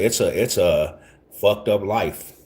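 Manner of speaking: usual speaking voice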